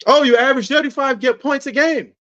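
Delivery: high-pitched pretend awe; mock admiration. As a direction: act impressed in a teasing lilt. Mimics fans questioning a ringless star.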